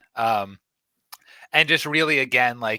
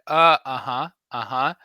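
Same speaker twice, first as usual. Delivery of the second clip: condescending uh-huh; 4 semitones higher, 8 dB louder than usual. Retold or imitated. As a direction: humor her like a kid telling a story. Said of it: "like a kid is telling him a story".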